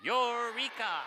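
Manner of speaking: high, loud shared shout; group eureka. Shown as a demonstration. Author chanting with the children, high and loud. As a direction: call it out together on the count. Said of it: "let's say that together".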